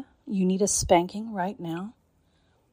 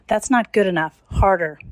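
loud scolding command; 11 dB louder than usual. Acted. Ordering harder spanks.

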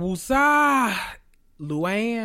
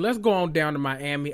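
usual speaking voice

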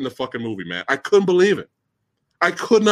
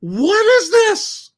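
high, drawn-out incredulous cry; exasperated disbelief; 13 semitones higher, 4 dB louder, much slower than usual. Real, not acted. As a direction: demand an explanation, high and slow. Outraged reaction to bad film editing.

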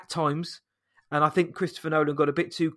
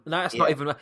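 normal talking voice

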